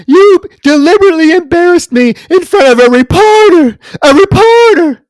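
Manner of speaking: shrill, high, loud hysterical Joan; Joan Crawford screaming. Retold or imitated. Podcaster imitating Joan raging at her daughter, shrieking. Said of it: "Remember, Joan's like".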